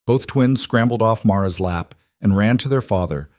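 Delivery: ordinary delivery, nothing marked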